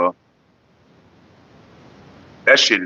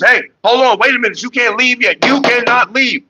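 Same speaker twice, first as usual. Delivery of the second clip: nurse calling after them; 10 semitones higher than usual. Retold or imitated. Nurse calls after son wheeling mom out, high and bossy. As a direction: mimic a bossy nurse trying to stop them. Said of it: "The woman goes".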